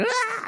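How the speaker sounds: sudden high yelp; mock startled shriek as it bites. Woman bitten by the camel gives a sudden high yelp. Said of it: "she just went like".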